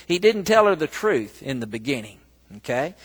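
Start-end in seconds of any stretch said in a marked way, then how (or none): none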